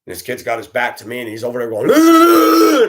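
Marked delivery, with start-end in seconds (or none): 1.9-2.9 long, loud, high mock holler; imitated yelling. Kid yelling at everybody on the bus, long mock holler. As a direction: hold one high yelled note, imitating a kid. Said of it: "and yelling at everybody".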